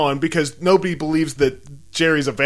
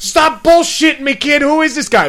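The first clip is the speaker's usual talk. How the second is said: loud, high-pitched exasperated scolding; angry mom; 7 semitones higher, 7 dB louder than usual. Acted. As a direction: yell at the kid like an outraged parent. Acts out mom scolding the kid.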